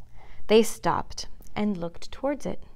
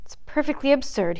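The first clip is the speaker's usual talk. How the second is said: mildly resolute, pitched up; painful decision; 5 semitones higher than usual. A narrator's reading. Bambi reproaching himself for his fear, painful decision. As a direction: declare it with reluctant resolve. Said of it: "he said with painful decision".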